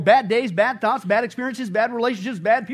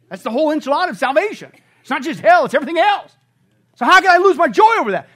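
louder, higher, faster fervent preaching; building preaching; 9 semitones higher, 10 dB louder than usual. Real, not acted. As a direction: rise to a shout of conviction. Preaching climax.

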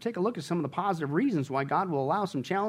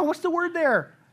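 ordinary talk, nothing marked

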